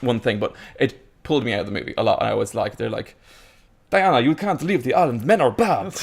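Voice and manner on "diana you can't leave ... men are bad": high, hammed-up mock Amazon accent; overacted Amazon accent. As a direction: ham up a thick foreign film accent. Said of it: "It's overacting".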